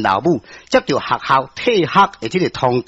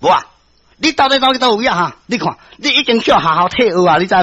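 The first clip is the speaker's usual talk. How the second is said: angry mother scolding, higher-pitched; angry loud scolding; 7 semitones higher, 3 dB louder, faster than usual. A narrator's reading. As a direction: scold your daughter in a raised, agitated voice. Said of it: "非常的生气，嘛真大声". (真受氣、大聲責備)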